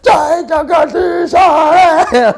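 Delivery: very high, drawn-out shout; screamed prayer. Retold or imitated. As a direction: bellow the prayer slowly, at full force. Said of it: "I finished the prayers".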